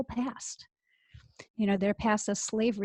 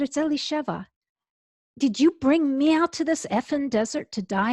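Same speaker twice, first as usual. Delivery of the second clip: retold angry protest, higher and a bit louder; angry at God; 5 semitones higher, 3 dB louder than usual. Rabbi confronting God in the desert, angry and higher. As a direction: demand it of God, hurt and furious. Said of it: "became very angry at God".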